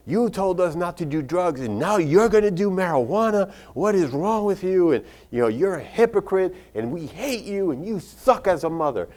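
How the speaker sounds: high, singsong scolding; children's reproach. Retold or imitated. Adult children berate their mother as a hypocrite, mock indignant reproach.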